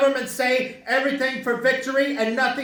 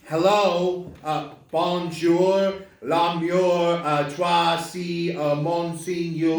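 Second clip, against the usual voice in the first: mock French gibberish, slow; fake French; 5 semitones lower, much slower than usual. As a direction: pretend to talk French on the phone. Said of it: "Why are you talking like that".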